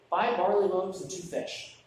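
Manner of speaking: ordinary talk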